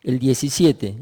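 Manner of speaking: ordinary talk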